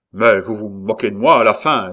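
éclat furieux, plus fort; crie-le, blessé dans son honneur; s'écrie en colère. Acted. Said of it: "s'écria le comte pâlissant". (le comte, furieux contre Saint-Luc, s'écrie fort)

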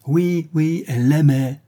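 high, teasing chant; sing-song taunt. A narrator's reading. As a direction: chant it to needle your cousin. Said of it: "chantonna Linton".

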